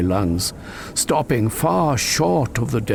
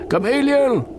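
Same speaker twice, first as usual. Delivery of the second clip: high, loud call across the snow; crying out; 3 dB louder than usual. Acted. Narrator calls the frightened goose, loud high cry. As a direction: call out to stop him. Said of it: "I cried".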